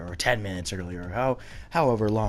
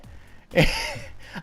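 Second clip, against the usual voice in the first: high shriek laugh. Real, not acted. Laughing at the outburst.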